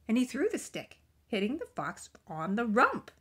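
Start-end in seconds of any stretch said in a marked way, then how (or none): none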